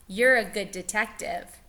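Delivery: usual speaking voice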